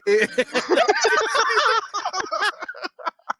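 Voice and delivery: long high cackle; long high laugh. Real, not acted. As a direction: laugh through the end. Laughs at own story.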